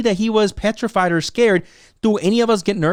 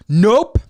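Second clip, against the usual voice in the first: NOPE popped louder and higher, rising; sassy nope; 4 semitones higher, 5 dB louder than usual. Retold or imitated. Imitating Ruby Rose dismissing the story, punchy NOPE. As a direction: punch NOPE upward as a kicker. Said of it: "I honestly think that Ruby Rose is like".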